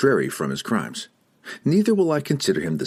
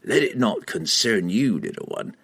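cold assassin voice. Acted. Entreri speaking.

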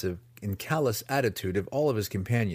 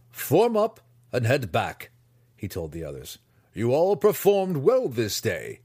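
commanding master; 4 semitones higher, 4 dB louder than usual. Acted. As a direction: bark the order to the troops.

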